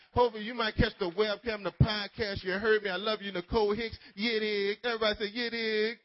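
big, loud preacher's shout-out. Real, not acted. Preacher hails his absent wife before the youth, loud shout-out. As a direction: call out loudly to the crowd.

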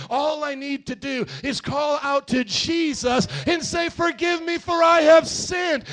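high, loud preaching, slowing on key words; full-force preaching. Acted. Pastor calling the congregation to confess to Jesus, full-force preaching. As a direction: preach it out to the back row.

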